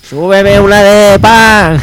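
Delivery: slow, high, loud street call. Pretend. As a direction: shout up to a balcony. Calling up to a window. (grito lento y agudo hacia arriba)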